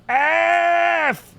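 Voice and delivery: long held high shout. Real, not acted. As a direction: wail the letter out. Game death cry.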